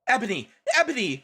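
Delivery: half-hearted pining call after her; mild mock shout. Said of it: "shouted Draco sadly".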